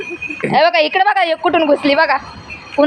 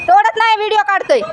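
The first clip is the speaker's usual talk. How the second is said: high, raised call across the field; calling out to uncle; 9 semitones higher than usual. Real, not acted. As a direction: insist from afar that nothing is picked. Calling out to shouting farmer.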